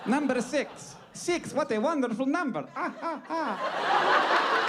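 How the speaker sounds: high, hammed-up vampire delight; theatrical vampire count. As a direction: relish the number, then drawn-out staccato laugh.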